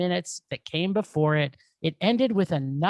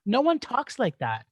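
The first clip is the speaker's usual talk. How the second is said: usual speaking voice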